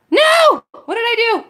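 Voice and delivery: sudden high, loud cry of alarm; shocked cry. Real, not acted. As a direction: yell it as the phone goes wrong. Phone mishap during stream.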